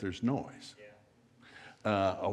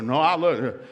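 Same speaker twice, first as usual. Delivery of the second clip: louder mock protest; a husband pushing back, loud; 3 semitones higher, 7 dB louder, slower than usual. Husband protesting about socks.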